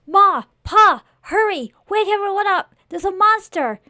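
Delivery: high, loud cry for help; panicked calling out. Acted. Boy calls Ma and Pa about the monster, panicked. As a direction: shout to rouse the whole house.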